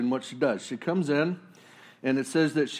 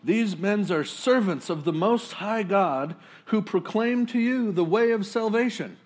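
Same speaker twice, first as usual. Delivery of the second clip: mock crying out; 7 semitones higher, 4 dB louder than usual. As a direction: announce it like a possessed herald. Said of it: "crying out".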